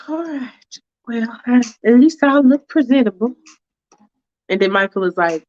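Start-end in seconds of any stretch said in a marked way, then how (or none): 0.1-3.3 higher, prim and hesitant; a fussy woman hedging politely; Faith's fussy voice. Acted. Faith hesitating to the driver, prim and higher.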